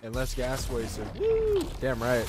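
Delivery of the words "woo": victory whoop. Real, not acted. Celebrating the win.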